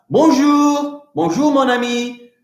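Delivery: bonjour, mon ami sung up high, French style; friendly French greeting. Shown as a demonstration. Teacher to pupils, a sung-up French greeting. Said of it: "I could say that".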